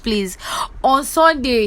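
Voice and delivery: normal talking voice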